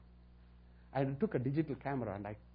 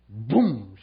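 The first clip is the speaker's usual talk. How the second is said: a sudden loud "Boom!" burst out; 6 semitones lower, 10 dB louder than usual. Retold or imitated. Preacher booming out the moment she fell. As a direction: shout it like an impact. Sermon story, person falls under power.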